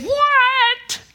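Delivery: high, loud, outraged What; emphatic What. Retold or imitated. Paul rejecting the Corinthians' conduct, a high loud emphatic What. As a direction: fling it out as a shocked objection. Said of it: "When he said".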